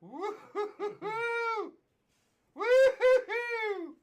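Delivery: real ecstatic whooping; laughing whoop. Real, not acted. Ecstatic at huge pull.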